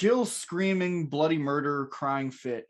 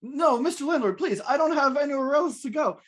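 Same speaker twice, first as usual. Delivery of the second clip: higher-pitched, hammed-up begging; pleading tenant; 9 semitones higher than usual. [name] begging the landlord for his cats, high pleading. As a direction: plead the case as a hapless tenant. Evicted tenant begs to stay.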